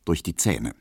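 plain reading voice

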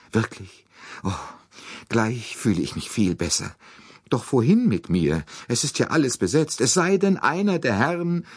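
high, chattering lady voice; fussy American lady. Acted. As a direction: gush and fuss like a nervous matron. Mrs Hubbard voiced.

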